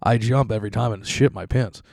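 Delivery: ordinary talk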